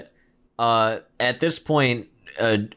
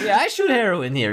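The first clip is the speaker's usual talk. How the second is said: higher-pitched comical Russian imitation; over-the-top Russian accent; 9 semitones higher than usual. Junkie insists he shoots up here, over-the-top Russian accent. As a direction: put on a silly Russian accent. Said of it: "really over-the-top Russian accent".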